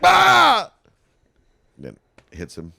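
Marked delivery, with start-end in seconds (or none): 0.0-0.7 high, loud panicked yell; imitated post-traumatic scream. Bruce flinching at a fake bottle, imitated scream. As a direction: scream in mock terror. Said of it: "All post-traumatic".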